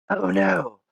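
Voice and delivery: high, pleading damsel cry; mock damsel in distress. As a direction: play it like a helpless damsel, hammed up. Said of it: "and I say".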